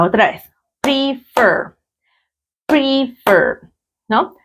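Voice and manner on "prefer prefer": Prefer pulled apart into PRE and FER, higher pitched; syllable by syllable. Shown as a demonstration. Teacher asking where the stress falls, Prefer said syllable by syllable. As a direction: stretch both syllables of Prefer, falling on FER. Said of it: "¿dónde la sienten ustedes que está?".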